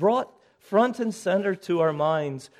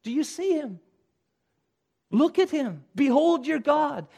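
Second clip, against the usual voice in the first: higher, eager herald's cry of discovery; preacher voicing a herald who sees God arriving, eager and higher; excited herald; 7 semitones higher than usual. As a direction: point him out, thrilled he's come. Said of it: "Now this third voice says".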